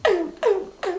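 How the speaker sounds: high mock puppy yaps; imitating the barking again, lighter. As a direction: yap it out like a small dog. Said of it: "the whole time he was just like".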